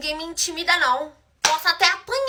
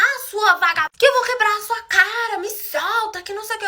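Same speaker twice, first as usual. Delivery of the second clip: higher-pitched mock cursing rant; retold angry insults; 6 semitones higher than usual. Rival cursing the storyteller while being held, mock angry. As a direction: imitate her hurling insults, pitched up. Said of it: "ela começou a me xingar de todo tipo de nome".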